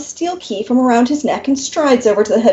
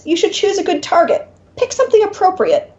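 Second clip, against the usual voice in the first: advising demon; 7 semitones higher than usual. Acted. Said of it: "the demon advises".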